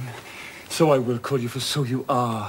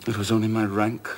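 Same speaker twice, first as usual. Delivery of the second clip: ordinary talk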